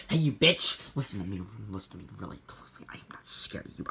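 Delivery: fast threatening whisper; menacing whisper. Acted. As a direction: hiss a threat under the breath. Threatening the ghost.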